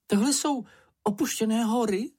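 very high, squeaky question; high-pitched inquisitive voice; Čenich, curious little creature. A narrator's reading. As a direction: ask it in a tiny high voice.

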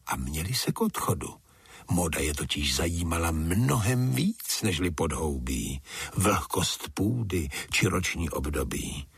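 plain reading voice

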